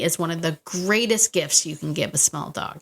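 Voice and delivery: ordinary talk, nothing marked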